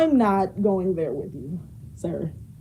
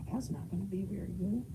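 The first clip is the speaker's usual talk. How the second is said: muttered aside; 14 dB quieter than usual. Real, not acted. Repeats line, first muttered.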